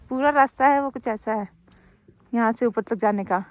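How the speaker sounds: ordinary talk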